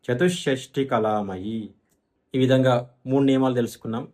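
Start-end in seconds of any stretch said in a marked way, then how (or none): 0.1-1.7 "చతుషష్టి" with a held, doubled sh, then slow "కళామయీ"; విసర్గం పొల్లు షా. Shown as a demonstration. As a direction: stretch the sh inside "చతుషష్టి", no hu. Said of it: "విసర్గము పొల్లు షాగా మారుతుంది". ("చతుషష్టి"లో ష్ష ఒత్తి, నెమ్మదిగా)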